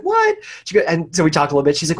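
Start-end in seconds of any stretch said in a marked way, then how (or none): 0.0-0.4 a sharp, very high disbelieving what; mimicking the friend's shocked reaction. Friend hearing he was fired, squeaking what very high.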